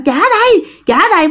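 high, sharp grab-back demand; snatch the words like a greedy child; ghost child snapping. Retold or imitated. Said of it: "Bắt đầu quay qua bạn".

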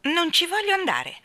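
put-on high, loud childish protest; defiant refusal. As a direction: refuse it like a stubborn little girl.

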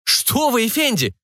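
high-pitched, fussing protest; flustered barker. Acted. Barker placating a threatening customer, flustered. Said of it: "засуетился зазывало".

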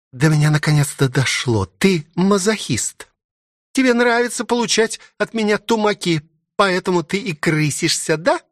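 high-pitched, taunting realization; accusing princess. Acted. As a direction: tease him accusingly as the princess.

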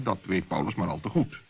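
ordinary delivery

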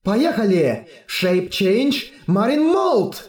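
rising to a high transformation cry; strange tone. Acted. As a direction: call out the transformation, climbing high. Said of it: "произнесла странным тоном".

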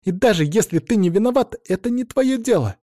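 high, angry yelling; angry shout. Acted. Rabbit girl yelling at Max.

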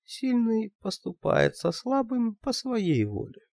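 high, slow, alluring; seductive high voice. Acted. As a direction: purr it like a temptress. Лия answers Valgard.

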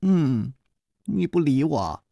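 put-on high, pouty girlish protest; coquettish pouting. A narrator's reading. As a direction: wheedle and sulk like a spoiled sweetheart. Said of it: "撒娇说".

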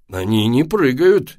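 cross, insistent dad; angry. A narrator's reading. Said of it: "сердито сказал папа".

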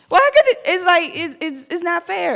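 high, loud indignant burst; indignant outburst. Real, not acted. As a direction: exclaim at the unfairness. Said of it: "indignant outburst".

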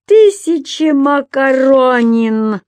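high, loud, drawn-out lament; acted moan of disbelief; loud groan. A narrator's reading. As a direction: wail it slowly in horror. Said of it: "простонал господин Пепперминт".

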